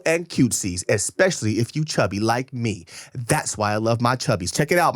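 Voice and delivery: lively podcast ad read, punched words. Acted. Host reads a clothing ad.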